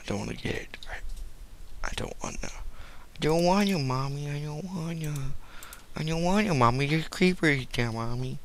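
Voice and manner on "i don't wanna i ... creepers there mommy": put-on 1 childish whining. Child voice to mommy.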